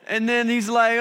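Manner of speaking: normal talking voice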